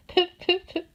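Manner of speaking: high, small whimpered puffs; whimpering. Acted. Fox whimpering as Brownie hesitates, whimpering. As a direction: whimper it weakly. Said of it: "whimpered the fox".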